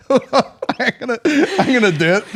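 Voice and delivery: high-pitched words through laughter; real laughing speech. Real, not acted. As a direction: struggle to get it out while laughing. Still cracking up after impression.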